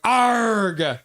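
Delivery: loud, drawn-out frustrated yell; shouted "arg". Retold or imitated. Host voicing a viewer's chat message, yelling.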